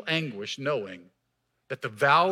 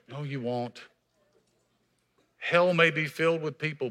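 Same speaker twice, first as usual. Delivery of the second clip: usual speaking voice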